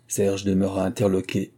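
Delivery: usual speaking voice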